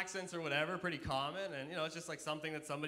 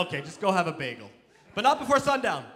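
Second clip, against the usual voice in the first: exasperated dad; 2 semitones higher, 12 dB louder than usual. Acted. Dad giving up on son.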